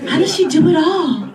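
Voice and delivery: high, slow mock gushing; fake-amazed crowd cooing; gushing audience. Comic mocking how the crowd would gush over a puppy mom. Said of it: "y'all would have been like".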